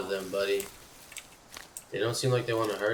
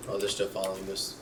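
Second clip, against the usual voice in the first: ordinary delivery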